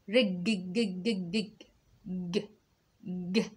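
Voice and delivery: clipped sukun on the jeem; sukun jeem sound. Shown as a demonstration. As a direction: let the ج stop short with no vowel. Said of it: "اسمع معايا كده". (جيم ساكنة مقطوعة)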